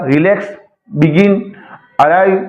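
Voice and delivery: "Relax", "begin", "arrive" stressed on the second syllable; spaced examples "relax, begin, arrive", second beat strongest. Shown as a demonstration. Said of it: "दूसरे syllable पर stress आता".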